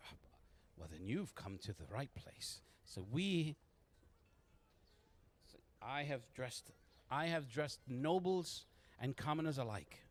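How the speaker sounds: lilting woman's sales patter; genteel haberdasher madam. Acted. Marjorie selling her tailoring to a customer, genteel and inviting. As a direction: draw out the boast, then coax them in.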